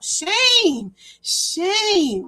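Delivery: high drawn-out shame, shame; mock shaming. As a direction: wag a finger with it. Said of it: "Let me say it in my Virginia voice".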